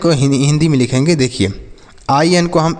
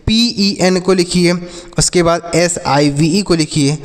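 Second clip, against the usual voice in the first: ordinary talk, nothing marked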